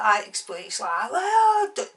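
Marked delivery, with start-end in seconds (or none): 1.1-1.8 high, mock-upset cry; upset man. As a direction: imitate him taking offence. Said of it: "all upset 'cause I called him".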